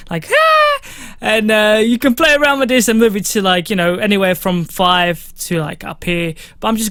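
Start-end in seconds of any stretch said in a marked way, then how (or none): 0.3-0.8 short yelp up high to show his high voice; a quick high-pitched demo cry. Shown as a demonstration. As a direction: squeak it out, very high and loud. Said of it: "like me".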